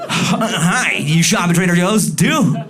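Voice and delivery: high, loud fake-friendly hello; awkward greeting. Man greets a girl at Trader Joe's with forced cheer. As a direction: chirp it too eagerly from afar. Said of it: "you're like".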